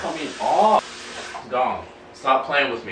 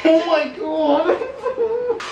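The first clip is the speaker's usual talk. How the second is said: slow drawn-out laughing cry; laughing in pain; 11 semitones higher, much slower than usual. Real, not acted. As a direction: laugh through the sting. Right after wax strip pulled.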